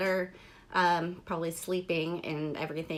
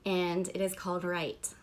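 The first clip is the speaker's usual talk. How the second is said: ordinary talk, nothing marked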